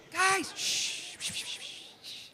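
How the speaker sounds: quiet the room down with shushes; shushing crowd. Real, not acted. Compere hushes a noisy audience, soft shushing.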